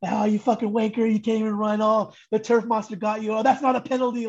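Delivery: a half-hearted mock taunt from the stands; mocking heckle. English fans taunting the fallen American player, mock jeering. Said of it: "talking shit".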